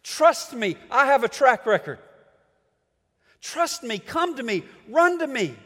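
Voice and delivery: preacher voicing God loudly. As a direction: call the people home with urgency. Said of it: "God keeps telling us".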